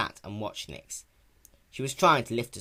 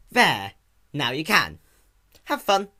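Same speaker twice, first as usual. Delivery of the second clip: louder, sarcastic; snide dismissal; 6 semitones higher, 9 dB louder, slower than usual. A narrator's reading. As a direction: toss it over your shoulder. Spike leaving spitefully.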